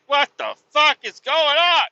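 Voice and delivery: high, loud exasperated yell; squawking, frustrated shout; angry outburst. Retold or imitated. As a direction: yell it at the TV in comic fury. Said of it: "Just get so angry at the T V".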